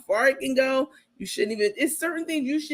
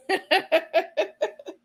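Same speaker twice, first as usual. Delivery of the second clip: high laugh; 8 semitones higher than usual. Real, not acted. Laughing at clip.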